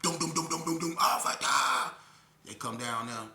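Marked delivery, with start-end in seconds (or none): 0.0-1.0 door banging imitation. 1.0-1.9 loud shout for the guard; calling out. Retold or imitated. Calling the guard after the fall, loud shout.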